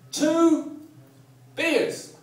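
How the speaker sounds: high, loud drawn-out call; mock tourist ordering. English holidaymaker abroad ordering a drink, mock calling out. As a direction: holler each word slowly, with a gap between. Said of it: "order a drink in the international language".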